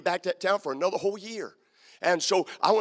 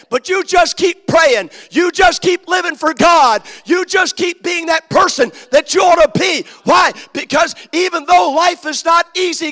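preaching at full force; 8 semitones higher, 12 dB louder than usual. Acted. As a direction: hammer each line to the rafters. Preacher climaxing on perseverance.

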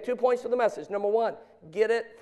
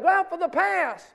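higher-pitched mock dad's call; 10 semitones higher than usual. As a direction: call it out across the yard. Imitates dads calling out to kids.

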